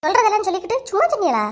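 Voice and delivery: fast, high rebuke; indignant scolding. Acted. Wife rejects husband's excuse, fast indignant scolding.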